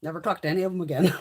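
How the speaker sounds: normal talking voice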